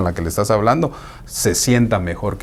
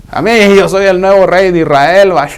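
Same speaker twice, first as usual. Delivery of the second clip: higher, louder boastful Absalom; mock royal boast; 7 semitones higher, 4 dB louder than usual. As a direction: proclaim yourself king proudly.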